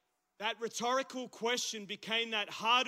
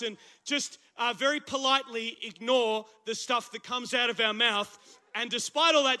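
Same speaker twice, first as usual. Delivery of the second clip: loud, raised preaching to the crowd; rally the room for the Philips; full-force sermon call; 3 semitones higher, 9 dB louder than usual. Real, not acted. Rallying the congregation.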